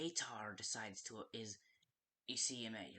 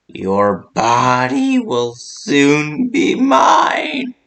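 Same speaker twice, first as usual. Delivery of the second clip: booming, slow, much louder villain taunts with big high swoops; menacing villain; 9 semitones higher, 27 dB louder, much slower than usual. Acted. The villain taunts the trapped player, roaring slow menacing threats. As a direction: loom over them and gloat, drawing every word out.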